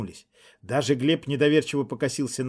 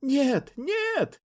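shrill, much higher panicked scream; 14 semitones higher than usual. Acted. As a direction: scream it out in terror. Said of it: "закричала в коридоре".

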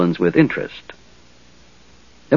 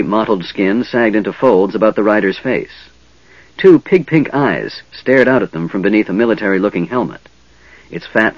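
usual speaking voice

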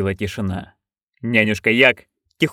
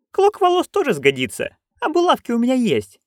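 put-on higher, eager old crone; Nanny Ogg voice; 13 semitones higher than usual. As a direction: pitch up into a gleeful old busybody. (высокий азартный голос старой ведьмы)

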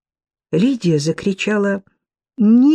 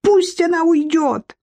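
shouting; 9 semitones higher than usual. A narrator's reading. Lidia demands Sudansky remove Sofya, shouting. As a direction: scream to get her out. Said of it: "Лидия стала кричать".

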